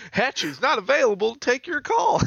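put-on high, loud old prospector voicemail; cackling old miner on voicemail. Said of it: "Old prospector looking for gold".

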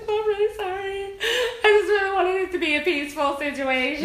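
high-pitched whiny apology; mock crying. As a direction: imitate a tearful man pleading his innocence. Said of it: "the dude that was crying".

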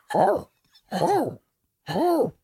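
high yappy barks, high pitched; imitated dog bark. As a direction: yap like a little dog in the background. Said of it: "and you hear a dog barking".

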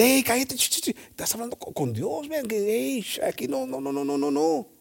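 the good thief hushing the mocker, high and urgent. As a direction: shush him urgently, high and hushed. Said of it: "hermanos, que dice".

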